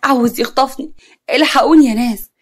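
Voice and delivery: acted scream, kept in check; narrated cry for help. A narrator's reading. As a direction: call out as if being kidnapped. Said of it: "صوتت وهي بتقول".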